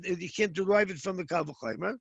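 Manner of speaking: ordinary talk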